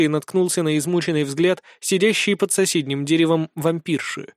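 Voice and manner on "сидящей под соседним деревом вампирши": usual speaking voice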